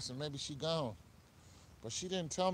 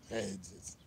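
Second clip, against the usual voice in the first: lower, lightly put-on villain voice; a touch of comic-book villain, pitched down; Two-Face voice; 6 semitones lower, 4 dB quieter than usual. Streamer replaying his coin-flip call, low villain voice. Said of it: "That's my Two-Face voice".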